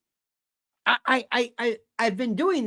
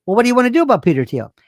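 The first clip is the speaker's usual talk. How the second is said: exasperated retort; 3 semitones higher, 7 dB louder, much faster than usual. Retold or imitated. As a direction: throw the question back impatiently, loudly. Acts out exasperated reply to her.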